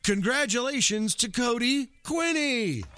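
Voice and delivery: big high winner reveal; booming prize announcer. Pretend. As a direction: announce the winner like a game show.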